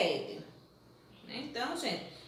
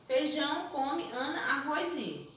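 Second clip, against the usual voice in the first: said as a sentence. Shown as a demonstration. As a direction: run "feijão, come, Ana, arroz e" together without pauses. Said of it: "Se eu chego pra você e digo".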